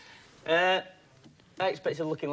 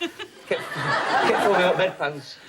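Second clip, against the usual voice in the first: laughing; 3 semitones higher, 5 dB louder, much slower than usual. Real, not acted. Laughing through his injury story.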